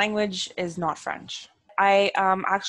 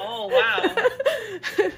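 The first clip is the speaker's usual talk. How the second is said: laughing; 4 dB louder than usual. Real, not acted. Reacts to the French, laughing.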